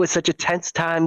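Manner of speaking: ordinary talk, nothing marked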